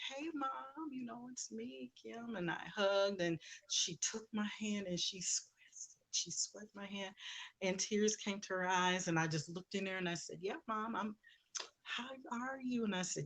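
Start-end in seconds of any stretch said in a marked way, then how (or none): 0.1-2.4 gentle greeting. Retold or imitated. 10.4-12.8 gentle greeting. Retold or imitated. Talking gently to her ill mother.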